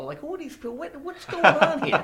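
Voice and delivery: mock New Yorker outrage. New Yorker baffled by friendly strangers, mock outrage. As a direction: play the baffled New Yorker who won't be asked how he is.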